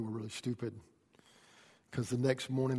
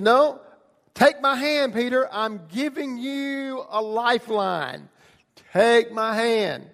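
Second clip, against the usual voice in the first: loud, high, stretched-out plea; commanding shout, words drawn out; urgent rescue call; 9 semitones higher, 10 dB louder, slower than usual. Acted. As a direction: call out urgently to someone drowning. Acted rescue of drowning Peter.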